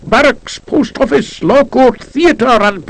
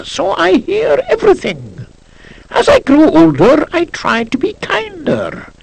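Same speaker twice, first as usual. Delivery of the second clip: put-on old emperor's inscription; 7 semitones higher than usual. A narrator's reading. Narrator voicing the blind old emperor's inscription, a hint of a frail, lofty old man. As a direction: read it as a small blind ruler's gentle boast. Emperor's words under portrait.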